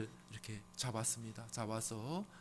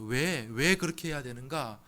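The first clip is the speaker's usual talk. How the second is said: ordinary talk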